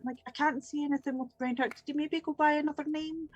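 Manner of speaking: put-on chatty old lady. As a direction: prattle helpfully in a lifted voice. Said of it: "where the old lady is".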